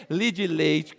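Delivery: "Li de leite" as clipped Mineiro "li di leitch"; Mineiro three syllables. Shown as a demonstration. Comedian showing Minas speech, "Li de leite" contracted Mineiro-style. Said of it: "Em Minas você compra o quê?".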